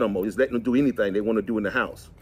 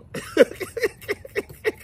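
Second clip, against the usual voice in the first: high airy laugh. Real, not acted. Laughing at his story.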